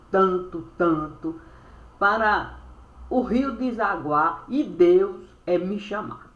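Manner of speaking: usual speaking voice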